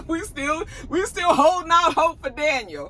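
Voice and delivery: high-pitched, laughing delivery; amused joking. Real, not acted. Joking after laughing at own idea.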